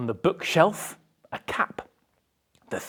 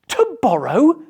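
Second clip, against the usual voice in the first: higher, louder dawning idea; excited realisation; 8 semitones higher, 5 dB louder than usual. Acted. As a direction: say it as the idea clicks.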